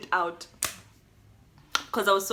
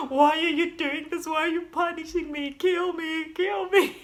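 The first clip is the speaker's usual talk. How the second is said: high, tearful plea to God; desperate prayer; 8 semitones higher than usual. Retold or imitated. To God during labor, high tearful pleading. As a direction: beg God with a laughing sob.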